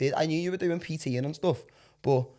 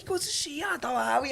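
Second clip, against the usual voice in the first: mock fan greeting; 9 semitones higher, 4 dB quieter than usual.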